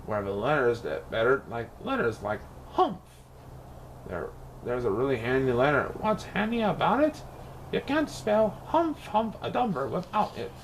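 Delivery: plain reading voice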